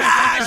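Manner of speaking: loud, high imitated yell; drawn-out mock holler; mock scream. Ex running out after him, loud mock scream. Ex's scream, acted in retelling.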